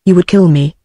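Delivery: ordinary delivery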